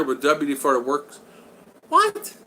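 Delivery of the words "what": very high, startled yelp; surprised what. Real, not acted. Hearing about WD-40 on a headlight, startled disbelief. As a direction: jump up in disbelief. Reacts to a chatter's comment.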